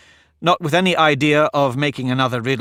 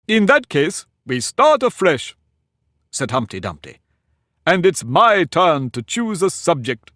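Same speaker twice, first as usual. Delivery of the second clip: high, slow, lordly Humpty Dumpty voice; pompous egg lecturing a girl; haughty egg; 4 semitones higher, 4 dB louder than usual. Acted. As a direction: talk down to her from the wall, higher and slower. Humpty taking charge.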